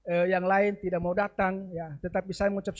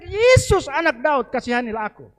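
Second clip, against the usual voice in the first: shouting plea; 5 semitones higher, 5 dB louder than usual. Acted. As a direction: cry out harder than ever. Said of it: "Semakin dia kuat berteriak". (teriakan keras memohon)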